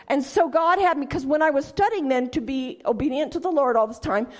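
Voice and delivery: ordinary talk, nothing marked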